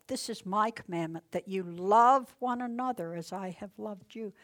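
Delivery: preacher reading red letters aloud; scripture reading. Acted. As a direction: read the words of Jesus with weight.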